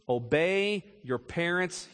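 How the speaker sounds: slow, weighty reading of the verse; slow scripture reading. Shown as a demonstration. Preacher reading Colossians to children, slow and solemn. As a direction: lay each word down slowly. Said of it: "here it is, catch it now".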